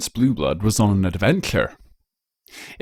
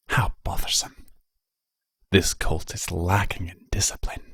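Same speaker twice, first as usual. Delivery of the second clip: soft, slow, scolding whispers from the trees; tut-tut at the colt in a hush; hushed disapproving whispers; 3 dB quieter, slower than usual. A narrator's reading. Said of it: "had turned to hushed voices, hissing their disapproval".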